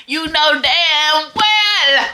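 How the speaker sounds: slow emphatic telling-off; scolding. Real, not acted. Scolding the character.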